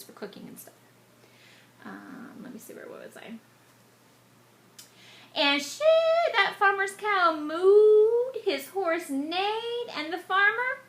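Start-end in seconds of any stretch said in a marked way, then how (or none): 5.3-10.8 high, loud, slow excitement; excited storytelling. A narrator's reading. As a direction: build it up with wonder. Animals and farmer excited.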